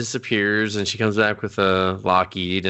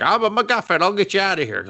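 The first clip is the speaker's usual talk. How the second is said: lightly voiced bear; 10 semitones higher, faster than usual. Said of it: "He was just like".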